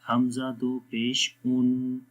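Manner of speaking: slow, measured recitation on a level pitch; drawn-out, evenly intoned syllables; Quranic spelling drill. Shown as a demonstration. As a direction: chant each letter, vowel and syllable.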